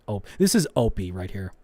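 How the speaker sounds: usual speaking voice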